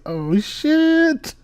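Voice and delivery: high, drawn-out scandalised reaction; mock shock. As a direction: stretch it out in gleeful mock alarm.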